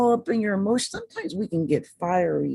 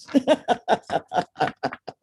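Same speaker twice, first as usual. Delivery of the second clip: laugh; 4 semitones higher than usual. Real, not acted. Laughs at own delivery.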